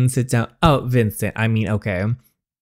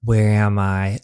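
ordinary delivery, nothing marked

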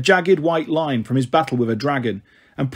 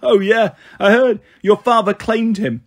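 higher, louder mocking delivery; amused taunt; 6 semitones higher, 4 dB louder than usual. A narrator's reading. Luke mocks Percy about his father claiming Tyson, amused. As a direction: taunt him, coming off a laugh. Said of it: "Luke laughed".

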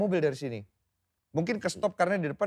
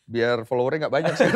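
loud, breaking into big laughter; amused, laugh takes over; laughing joke; 7 dB louder than usual. Real, not acted. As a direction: say it laughing, then crack up. Jokes, laughing at own remark.

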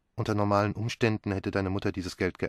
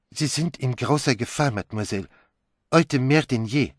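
urgent warning; 4 semitones higher than usual. Acted. Poirot warns Pilar of danger.

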